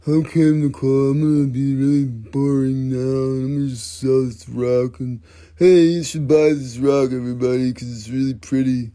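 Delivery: lightly put-on bored seller voice; a hint of sarcastic boring pitch; mock boring salesman. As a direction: play the dull rock seller for the wife.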